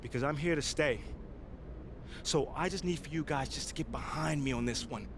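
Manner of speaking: firm public address, building to passionate peaks; press conference speech. Acted. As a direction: make your case to the reporters, rising as you push. Player speaks at press conference.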